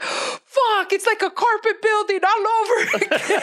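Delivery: squealed, high-pitched comic despair; hammed-up horror at being back at the office; mock dismay. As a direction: wail it way up high through laughter. Acts out arriving at corporate building.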